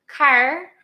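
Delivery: asking a friend if he says car so. Shown as a demonstration. Loud car with a hard R. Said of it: "Do you say it like that?".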